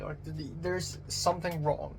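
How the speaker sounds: normal talking voice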